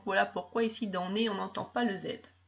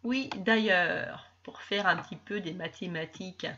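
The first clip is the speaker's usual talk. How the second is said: ordinary delivery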